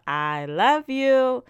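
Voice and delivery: drawn out, high and loud I love you; slow, earnest sign-off; heartfelt thanks. Real, not acted. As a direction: tell the listeners you love them, slowly. Thanking listeners at the end.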